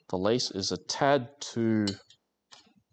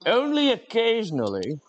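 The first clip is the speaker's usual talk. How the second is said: "Only occasionally" squeaked much higher, playful; mock squeak; 12 semitones higher, 4 dB louder than usual. Streamer joking the stream is only occasionally on fire, high mock squeak.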